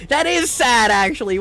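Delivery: loud outburst; sudden loud reaction. Real, not acted. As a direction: blurt it out loud. Streamer reacting loudly.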